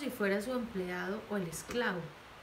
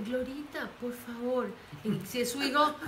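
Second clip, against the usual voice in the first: ordinary talk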